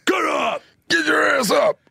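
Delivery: high, drawn-out holler; hammed-up shout, very slow and stretched; mock wake-up shout. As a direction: play a coach-like ghost yelling someone awake. Imagined ghost yelling to wake him.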